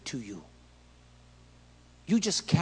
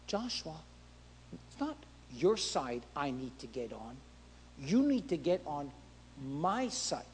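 softer, calm authority; quiet divine answer; 2 semitones lower, 7 dB quieter than usual. Acted. God gently telling Joshua to get on his side, quiet.

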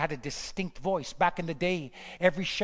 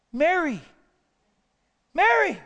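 high, loud call of her name, each word falling; Jesus calling out; 11 semitones higher, 9 dB louder than usual. Acted. Jesus calling Mary by name at the tomb, loud and high.

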